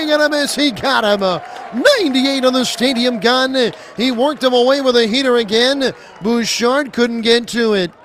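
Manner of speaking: loud, high play-by-play roar to end the inning; excited strikeout call. Real, not acted. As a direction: sell the big strikeout, full energy. Big strikeout ends the inning.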